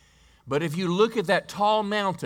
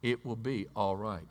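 calm reassurance; 5 semitones lower, 6 dB quieter than usual. Acted. Mother repeating her faith.

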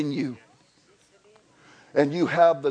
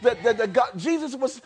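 ordinary delivery

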